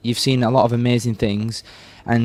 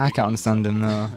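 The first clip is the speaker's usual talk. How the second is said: normal talking voice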